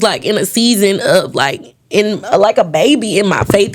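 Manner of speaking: ordinary talk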